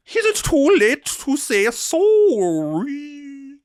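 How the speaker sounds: high, slow mock-French singing; drawn-out falsetto attempt at a French accent; bad French accent. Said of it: "I can't do fucking accents at all".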